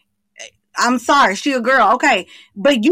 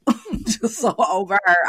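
laughing; 3 semitones lower, 3 dB quieter than usual. Real, not acted. Laughing while dismissing her.

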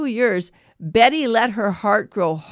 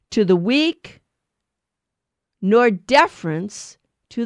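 slow, solemn proclamation of the reading; scripture reading; slower than usual. Acted. Priest reading Leviticus aloud to the congregation, slow and solemn. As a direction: read it out to the congregation like a lector, unhurried.

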